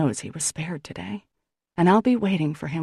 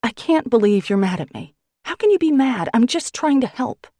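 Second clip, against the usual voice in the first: higher, louder hurt outburst; woman stung by his anger, raised and higher; temper snapping; 6 semitones higher, 5 dB louder than usual. Acted. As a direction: fire it back at him, indignant. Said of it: "My temper snaps".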